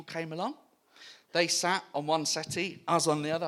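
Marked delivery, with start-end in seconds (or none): none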